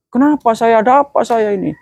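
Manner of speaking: high, frantic bewildered cries; panicked soul. Acted. Preacher voicing a dead man seeing his family weep, high frantic cries. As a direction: cry out lost and terrified.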